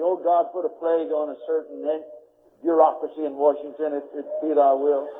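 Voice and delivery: mock-solemn prayer for Washington. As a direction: pray it under the breath of the sermon. Prays aloud in sermon.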